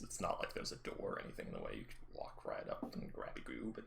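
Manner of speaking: low unvoiced sly coaxing; tempting whisper. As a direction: coax them like a little devil. Devil on your shoulder.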